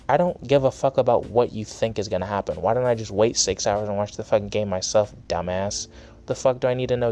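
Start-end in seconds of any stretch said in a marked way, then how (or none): none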